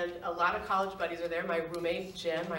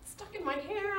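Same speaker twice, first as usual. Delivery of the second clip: much higher stammering mock fuss; fretful whine; 12 semitones higher, 4 dB quieter than usual. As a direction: fuss over wet hair, half laughing. She frets about her hair.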